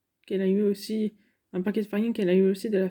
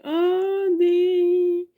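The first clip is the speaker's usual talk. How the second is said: surprised oh; 9 semitones higher, 4 dB louder than usual. Real, not acted. Spots treats in the kitchen.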